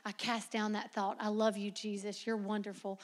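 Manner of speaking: soft, low murmured prayer; quiet prayer. Retold or imitated. Young woman praying away thoughts of Spencer, softly. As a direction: pray it under your breath. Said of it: "I'd be like".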